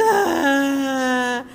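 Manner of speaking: a loud mock cry of a tired little girl; imitated child's wail. Retelling the plane story, mimics the crying girl with a loud mock wail. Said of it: "e a menina chorando".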